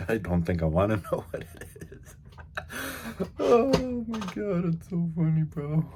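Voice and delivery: high, loud helpless laughter through the words; laughing. Real, not acted. Vlogger amused by his own strange visuals, laughing. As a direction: crack up while talking, voice climbing.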